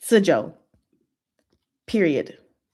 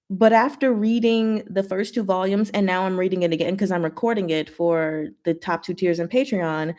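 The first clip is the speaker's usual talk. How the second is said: usual speaking voice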